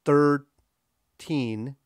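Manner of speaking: "thirteen" split into two slow, equal beats; THIR... TEEN, both syllables full; equal stress. Shown as a demonstration. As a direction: stretch "thirteen" with a gap between equally stressed halves. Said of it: "equally stressed".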